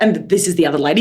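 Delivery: ordinary talk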